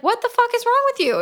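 high, fast alarmed cry; startled outburst. Retold or imitated. Boyfriend yelling at girlfriend who grabbed the wheel, high fast shock. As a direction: yelp it in shock as she grabs the wheel. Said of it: "And he's like".

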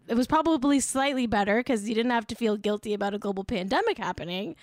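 ordinary talk

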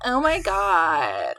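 put-on slow, stretched Valley Girl gasp. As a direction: drag it out like a swooning teen. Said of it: "annoying Valley Girl, teenage girl voices".

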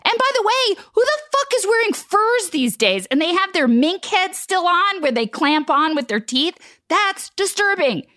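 mock-outraged tirade, pitched high; rising rant. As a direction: build into a shrieking tirade about furs. Rant about furs.

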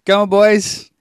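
high, loud urging call; cox's rallying shout. Shown as a demonstration. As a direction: holler to get the crew moving. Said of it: "the way they would say it".

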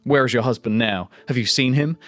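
ordinary talk

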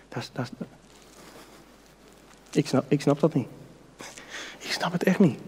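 dropping to a near whisper; quietly overwhelmed, almost breathless; hushed awe. Real, not acted. Preacher marveling at grace, hushed near whisper.

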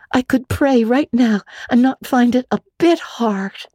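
put-on high, quick young girl voice; a chattering imaginative orphan girl; Anne, girlish and eager. Anne complains to Marilla, high girlish voice. Anne's voice.

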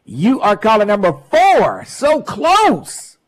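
loud, high teasing DJ countdown; radio contest promo. Real, not acted. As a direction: build suspense for the next caller.

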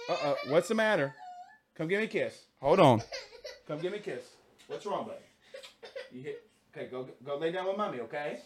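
gently calm a little son who is crying. Real, not acted. Comforting his crying little son.

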